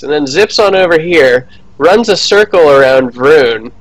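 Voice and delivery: normal talking voice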